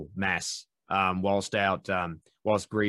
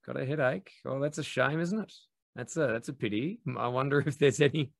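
softer sly innocence; 3 semitones higher than usual. Retold or imitated. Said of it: "where you go".

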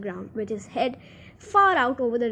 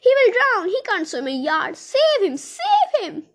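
high, loud panicked pleas; theatrical shrieking, climbing very high; screaming; 8 semitones higher, 5 dB louder than usual. A narrator's reading. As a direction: scream frantically for rescue. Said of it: "screamed Miss Gloop".

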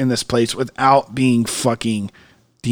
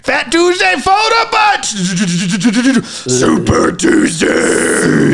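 high, drawn-out hype shout; Super Tuesday! sung out with a stuttering build; excited cheer; 9 semitones higher, much slower than usual. Acted. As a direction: stretch it out like a party chant. Excited cheer for Mardi Gras.

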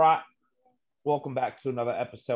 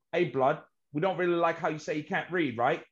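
higher-pitched mock London gangster accent; put-on London roadman accent, pitched up; English accent; 5 semitones higher, faster than usual. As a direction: play a polite British gangster. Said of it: "we're talking about English accents".

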